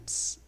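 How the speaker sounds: "Ps" as one voiceless burst; p straight into a hissed s, "Ps"; P-S sound. Shown as a demonstration. Teacher showing the sound of psi, "Ps" without any vowel. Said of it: "que é um som de P S".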